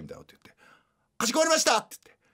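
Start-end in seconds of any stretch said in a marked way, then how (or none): 1.2-1.8 very high order acknowledgement; shrill service voice. Acted. Mother takes a student's order, high shrill service voice. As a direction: chirp it up high like café staff. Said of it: "すごい甲高い声で".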